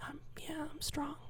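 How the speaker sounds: a breathy whisper of mock confidence; whispered sarcasm. Comic sarcastically promising to catch mom, whispered. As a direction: whisper it like you don't believe it. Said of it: "I'm like".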